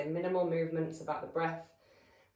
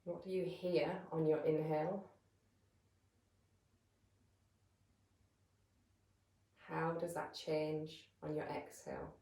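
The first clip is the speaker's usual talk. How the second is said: calm, measured meditation voice with long pauses; guided meditation; 7 dB quieter than usual. Real, not acted. Yoga teacher settling listeners into breath awareness, calm guided meditation. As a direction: guide them gently into stillness, leaving space between phrases.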